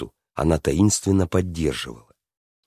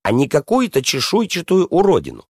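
high-pitched, fuming princess voice; princess boiling over; 9 semitones higher than usual. A narrator's reading. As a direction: spit the insult out high and indignant. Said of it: "вновь закипела принцесса".

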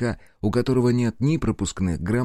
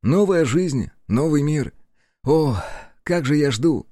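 high-pitched, eager anticipation; cheerful; 6 semitones higher than usual. Acted. Аяшики dreaming aloud of a new life, cheerful. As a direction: revel in what is coming. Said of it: "весело сказала Аяшики".

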